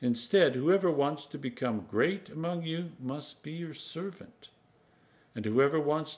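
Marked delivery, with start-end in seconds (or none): none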